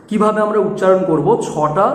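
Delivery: usual speaking voice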